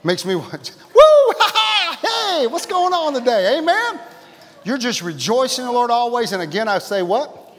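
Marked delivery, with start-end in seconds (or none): 0.9-4.0 high, loud burst of joy; exuberant whoop. Shown as a demonstration. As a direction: whoop and laugh, then holler out a greeting. Shows his urge to rejoice.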